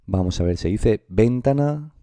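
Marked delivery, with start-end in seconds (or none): 1.1-1.9 ventana said slowly as VEN-ta-na; wrong stress on VEN. Shown as a demonstration. Teacher tests pupils with wrong option, ventana stressed as VEN-ta-na. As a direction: punch the VEN of ventana, as a question.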